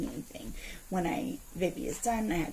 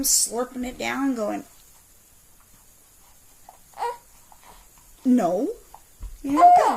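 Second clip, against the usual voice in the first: higher, much louder indignant tirade building to a yell; retold angry telling-off; 5 semitones higher, 6 dB louder than usual. Mom retelling yelling at a man who stared, furious. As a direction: blast the staring man with outrage. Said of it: "I was so angry".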